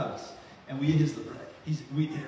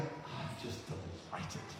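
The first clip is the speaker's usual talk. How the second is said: breathy, slow whisper of quiet joy; God's whisper; 11 semitones lower, 15 dB quieter, slower than usual. Acted. Pastor voicing God's delight in his children, whispered slowly. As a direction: whisper it as if God is glowing with joy. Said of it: "When he's going".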